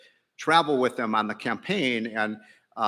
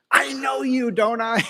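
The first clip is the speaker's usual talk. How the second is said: higher, eager woman's voice; excited woman; 12 semitones higher, 4 dB louder, slower than usual. Retold or imitated. Retelling a woman at the airport, in a higher eager voice. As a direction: imitate an excited fan recognizing him. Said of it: "she was so excited".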